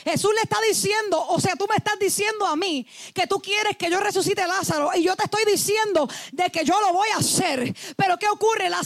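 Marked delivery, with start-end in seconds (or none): none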